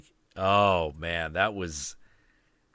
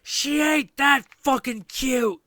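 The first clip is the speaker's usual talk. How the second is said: high, loud mock-angry mother; 8 dB louder, slower than usual. Mother on the bus cutting down her own kid, angry mock yell. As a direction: imitate an angry mother yelling it, high and drawn out. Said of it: "this angry voice saying".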